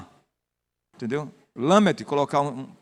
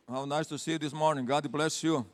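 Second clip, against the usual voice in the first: ordinary talk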